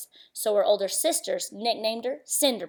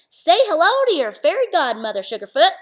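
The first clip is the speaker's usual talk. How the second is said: louder, higher, hearty frontier granny; old cowgirl godmother; 6 semitones higher, 8 dB louder than usual. Acted. Fairy godmother greeting Cindy, hearty old cowgirl. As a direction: boom it out like a cheerful old cowgirl. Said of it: "the old lady said".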